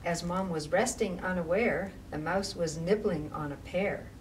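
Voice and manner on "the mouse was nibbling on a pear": usual speaking voice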